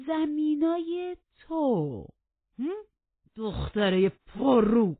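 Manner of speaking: slow, loud, incredulous outburst; outraged disbelief. Acted. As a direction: draw it out loudly in outrage. Heathcliff outraged at her answer.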